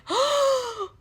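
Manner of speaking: high-pitched dramatic gasp; mock gasp of horror; shocked gasp. Teen who just got handcuffed, horrified gasp.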